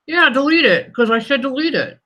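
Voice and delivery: loud, snippy mock insistence; snarky. Real, not acted. Vlogger snapping at her own post, snarky and loud. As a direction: snap it like an irritated bossy user. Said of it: "I get snarky".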